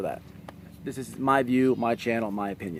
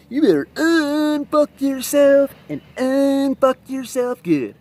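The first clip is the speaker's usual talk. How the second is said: hillbilly cop again; 14 semitones higher, 8 dB louder, slower than usual. Retold or imitated. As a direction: replay the guard's bellow with relish. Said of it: "I'll never forget how he said it".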